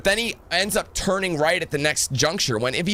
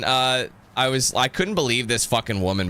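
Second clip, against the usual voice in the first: usual speaking voice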